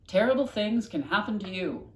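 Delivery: comic-book title announced; dramatic title. Acted. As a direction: announce it like a warning. Ominous comic title read.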